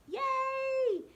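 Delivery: high bright cheer of praise; excited cheer. Real, not acted. Cheering a child's drawing.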